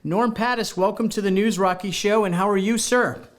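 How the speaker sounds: ordinary delivery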